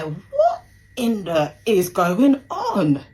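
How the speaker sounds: loud, high, drawn-out disbelief; retold frantic outburst. Daughter reliving her dad's arrest, frantic disbelief. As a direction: re-enact losing it in shock, stretching every word.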